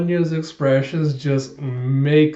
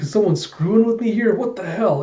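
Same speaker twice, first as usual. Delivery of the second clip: high-pitched exasperated complaint; acted exasperation; 7 semitones higher than usual. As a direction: protest as if the world is pranking you. Character hit by falling ingredients complains.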